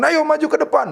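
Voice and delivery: high preacher's call to the congregation; calling forward. Retold or imitated. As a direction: re-enact summoning people up, pitched high. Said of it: "akhirnya saya katakan".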